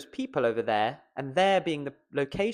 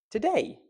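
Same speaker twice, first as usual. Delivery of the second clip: "today" with DAY pitched high; stress on DAY; 12 semitones higher than usual. Shown as a demonstration. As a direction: hit the second syllable of "today". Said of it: "We're stressing the word day".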